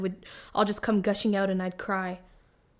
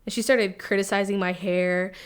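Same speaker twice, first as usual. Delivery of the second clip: normal talking voice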